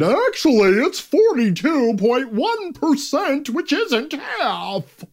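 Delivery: high, drawn-out know-it-all whine; mock pedant. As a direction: imitate a nitpicker, stretching each word. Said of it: "so that hopefully people won't put".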